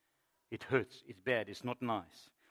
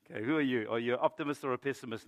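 usual speaking voice

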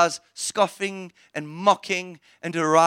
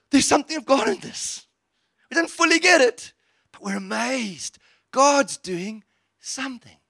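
amazed; 6 semitones higher than usual. Acted. Amazed group voiced.

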